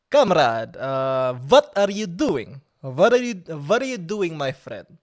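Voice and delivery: a mock Russian comrade greeting a friend; harsh Russian accent. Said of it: "a very harsh, um, accent".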